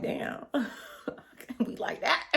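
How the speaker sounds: muttered under her breath, almost whispered; a laughing aside only she can hear. Retold or imitated. Muttering aside to herself, unheard.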